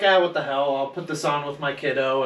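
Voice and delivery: normal talking voice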